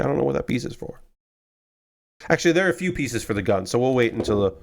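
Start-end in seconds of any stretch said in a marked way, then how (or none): 0.0-1.0 whispering. Retold or imitated. Retold thought, whispered.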